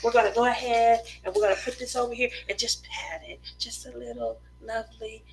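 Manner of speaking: soft murmuring painting teacher; gentle soothing art instructor; Bob Ross impression. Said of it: "he sounds like a performing, uh, performing arts, uh, instructor".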